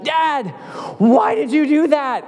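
high-pitched mock-furious little girl; furious toddler. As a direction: squeak out a toddler's outraged protest. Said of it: "she was so furious at me".

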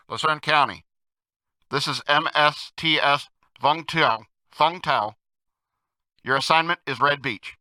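muffled through cupped hands. Retold or imitated. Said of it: "cupped his hands over his mouth and said in a muffled voice".

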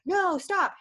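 put-on a quick high "No, stop" thrown at the kids; sharp call. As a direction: snap it out like a mom stopping muddy kids. Mother calling kids out of puddles.

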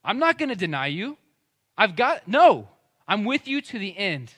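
loud, high, cocksure protest; Peter. Acted. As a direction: insist forcefully, full of self-confidence. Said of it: "Peter in his own strength said".

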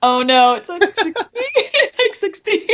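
high, squeaky laugh through the words. Real, not acted. Admitting to host what counts as cold, laughing. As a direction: laugh helplessly while speaking.

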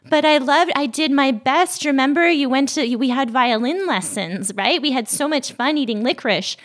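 hammed-up wounded mom defending herself; mock hurt parent. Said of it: "parental fragility where they're like".

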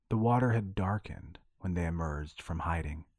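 ordinary delivery, nothing marked

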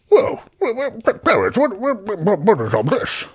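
high, stuttering astonishment; astonished Barry. Acted. Barry seeing the table and everyone, high stammering surprise. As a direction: splutter in a high, surprised character voice.

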